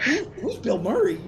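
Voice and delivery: bewildered high voice. Retold or imitated. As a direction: play it totally clueless. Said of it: "He's like".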